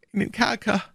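high, wailing prisoner voice; despairing echo. Acted. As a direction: repeat the order in high-pitched misery. Prisoner despairingly echoes the order.

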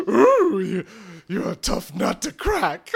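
loud high coo; loud high flirty coo. Acted. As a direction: coo it teasingly. Flirty Smithy.

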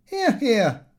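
high, sarcastic hear hear; mocking cheer. A narrator's reading. Lupin mocking his father in front of the guests, sarcastic cheer. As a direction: cheer mockingly to side with mother. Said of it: "Lupin said".

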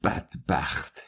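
hushed, quivering contempt; soft, anger held down; low angry voice. A narrator's reading. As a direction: mutter it softly, trembling with rage.

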